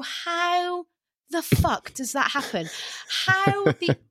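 high-pitched exasperated bafflement; utterly baffled, stretching out the key words; incredulous. Retold or imitated. Woman baffled by people who stop at one, incredulous. Retelling baffled reaction to others' restraint.

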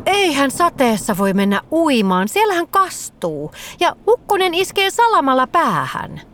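alarmed fox, climbing high; horrified protest. A narrator's reading. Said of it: "Vihreä kettu kauhistui".

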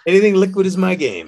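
pickup line delivery. Shown as a demonstration. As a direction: sell Anything liquid is my game as a smooth pickup line. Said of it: "what a great pickup line".

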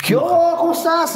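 breezy, put-on friendliness; mother coming upstairs to greet her son, high and cheery; mother's cheery greeting. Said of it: "sube en plan".

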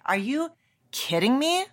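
high, rising "are you kidding me"; indignant mock outrage, voice jumping up; incredulous. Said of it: "first reaction being like".

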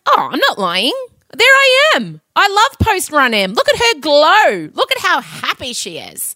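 high, gushing self-praise; gushing admiration. Retold or imitated. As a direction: rave about your glowing self. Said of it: "I'm like".

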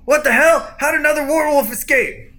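high, loud, alarmed shout; acted outraged cry of alarm; bandit yell. Bandit spotting an escaped werewolf, alarmed yelling. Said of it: "you just heard him yell".

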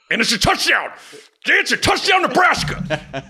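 soaring high excited play-by-play, stretched out on Nebraska; imitating radio touchdown call. Driver imitating the radio announcer's touchdown call, excited high yell. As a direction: belt it out like a radio announcer calling the score. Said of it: "I'm listening to Greg Sharp".

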